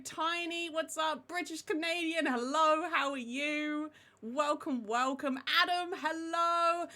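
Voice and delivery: usual speaking voice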